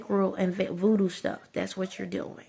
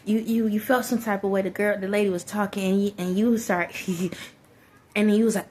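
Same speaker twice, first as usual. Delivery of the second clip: ordinary talk, nothing marked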